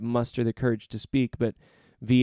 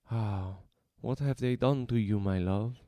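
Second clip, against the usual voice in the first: put-on soft, tender Spanish accent; cooing; 4 dB quieter than usual. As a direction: coo gently to a lost love. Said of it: "he coos".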